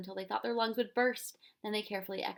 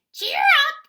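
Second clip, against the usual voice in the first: shrill, much higher, very loud bird squawk; 17 dB louder than usual. Acted. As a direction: screech it like a bird. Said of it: "she squawked".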